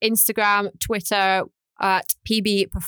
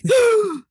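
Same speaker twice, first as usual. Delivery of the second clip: loud exaggerated gasp; mimicked heavy breath; 14 semitones higher, 5 dB louder than usual. Editor mimicking co-host's annoying breaths, loud gasp. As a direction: gulp air noisily, mocking a breather. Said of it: "it's also very annoying".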